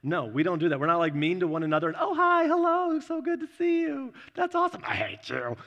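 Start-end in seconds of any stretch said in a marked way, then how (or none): 2.0-4.8 very high, sugary mock welcome; fake sweet greeting. Mocking fake sibling niceness. 4.9-5.6 a quick lower jab after the fake sweetness; mock spiteful aside.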